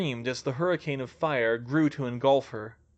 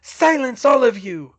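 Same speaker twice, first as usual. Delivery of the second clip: high, loud villainous order; imperious roar, falling in pitch; commanding shout; 10 semitones higher, 10 dB louder than usual. Acted. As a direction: silence your followers with a shout. Villain silencing his followers.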